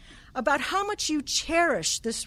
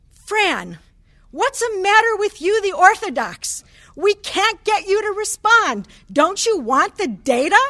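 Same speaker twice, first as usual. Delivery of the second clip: loud, high, exasperated retold demand; emphatic frustrated outburst; 8 semitones higher, 9 dB louder than usual. Professor scolding a junior colleague, loud and exasperated. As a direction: hammer the question at her like a crusty old professor who's fed up. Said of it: "he was very emphatic".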